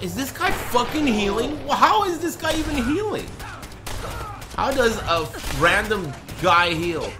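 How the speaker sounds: high, loud, incredulous yelling; disbelieving shout. Real, not acted. Gamer yelling at an enemy that keeps healing. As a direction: yell in disbelief at the screen.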